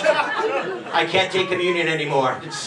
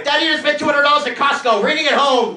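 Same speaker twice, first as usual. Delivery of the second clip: louder, higher dad rant; mock yell; 6 semitones higher, 4 dB louder than usual. Husband in bed yelling at his wife, mock yell. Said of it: "yelling at my wife".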